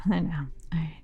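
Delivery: secretive hushed whisper. Pretend. Joking that Salem secrets are out.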